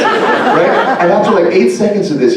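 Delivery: ordinary delivery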